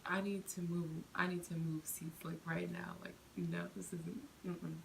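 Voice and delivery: hushed, voiceless, urgent; frightened under-the-breath plea; scared whisper. Retold or imitated. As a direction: whisper it so he won't hear. Panicked inner thought on train.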